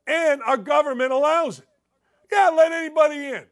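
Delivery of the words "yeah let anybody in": higher, quick sarcastic throwaway; mock permissive. Host mocking government that allows it, sarcastically permissive. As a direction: breezily wave everyone through in mockery.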